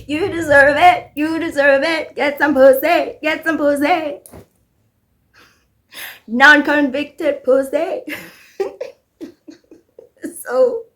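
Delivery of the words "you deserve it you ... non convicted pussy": egging him on, higher and louder; mock cheering crowd. The internet urging Ryan on, mock chant. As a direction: chant it like a rowdy fan. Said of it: "And the world's like".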